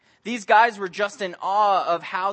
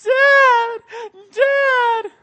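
put-on high, loud sobbing calls from a small boy; tearful little boy calling; crying child; 12 dB louder than usual. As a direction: cry out for your dad like a lost kid. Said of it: "I hear this kid crying".

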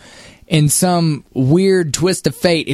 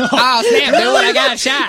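much higher, laughing mock shout; mocking dying line; 12 semitones higher, slower than usual. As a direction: ham up his silly death line. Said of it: "mocking his line".

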